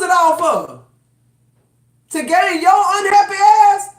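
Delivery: from firm to high, loud outraged yelling; building angry rant. Real, not acted. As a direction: build until you're shouting at them.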